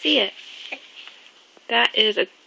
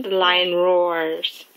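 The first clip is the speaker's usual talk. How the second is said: slow, lower storybook voice; drawn-out lion line for a child; reading to a toddler; 4 semitones lower, much slower than usual. Acted. Reading animal book to child.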